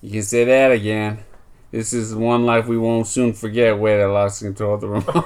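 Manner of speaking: put-on Boston accent. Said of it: "Do a Boston accent".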